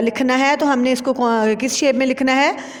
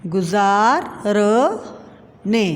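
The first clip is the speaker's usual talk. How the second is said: drawn-out sounding out, lower; slow spelling; 3 semitones lower, slower than usual. Shown as a demonstration. Teacher showing how to write the joined word, slowly sounded out. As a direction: stretch the word into its parts slowly. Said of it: "जोड़ कर लिखना है इस तरह से".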